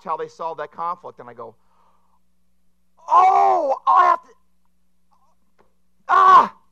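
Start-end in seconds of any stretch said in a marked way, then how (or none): 3.1-6.6 very high, loud cry of reaction; acted outburst. Preacher acting out his reaction to a movie, loud outburst. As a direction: burst out high at full volume.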